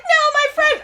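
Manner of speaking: high, loud mock-heroic cry; mock combat outburst. Elf girl's split personality switching in combat, mock outburst.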